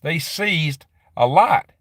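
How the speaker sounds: usual speaking voice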